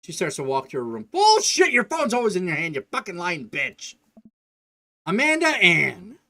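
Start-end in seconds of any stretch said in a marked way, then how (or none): 1.1-3.9 much higher, louder, spitting each word at him; a teen exploding in rage; furious yell. Acted. Teenage daughter screaming at her dad, furious. 5.1-6.2 loud, slow, drawn-out full-name scolding; the parent calling out the middle name; stern shout. Acted. Dad scolding his daughter by her full name, loud and slow.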